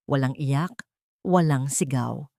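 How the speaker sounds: ordinary delivery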